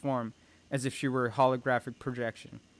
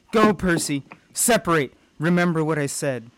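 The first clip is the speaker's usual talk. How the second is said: urgent, louder shout to flee; urgent command; 4 semitones higher, 6 dB louder than usual. Acted. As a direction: call out orders in a higher, raised voice. Mother orders Percy to flee the monster.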